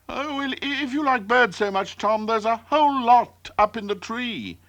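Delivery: high-pitched chick talking to the cat; chirpy high voice, quick start; little bird voice. A narrator's reading. Chirp sends the cat away.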